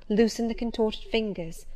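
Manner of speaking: ordinary delivery, nothing marked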